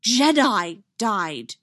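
usual speaking voice

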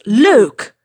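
loud cut-off shout of the name; sharp shout. A narrator's reading. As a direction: call out to stop him. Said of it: "didn't even let him finish".

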